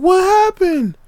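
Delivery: shrill mom demanding answers; alarmed mom. Retold or imitated. Mother asking kids about the injury, high alarmed. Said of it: "she's like".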